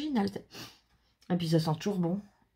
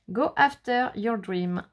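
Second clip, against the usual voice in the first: slow, careful English with French accent; 4 dB louder, slower than usual. Real, not acted. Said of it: "pareil en anglais".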